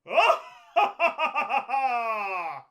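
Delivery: high, loud, falling villain cackle; evil laugh. Acted. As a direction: cackle like a possessed toy. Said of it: "I think your doll is laughing".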